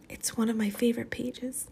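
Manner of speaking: soft, sad whisper. Acted. Amanda grieving her torn book page to Maniac, hushed whisper. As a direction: breathe it out, barely any voice.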